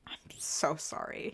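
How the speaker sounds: very soft, low whisper; hushed apology. Pretend. Friend apologizes to Rhett and Link, barely whispered. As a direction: whisper it like a guilty confession.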